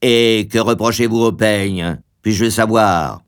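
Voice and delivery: mildly indignant husband; understated fury; furious. A narrator's reading. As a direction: challenge with a touch of anger, in your own register. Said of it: "dit-il furieux".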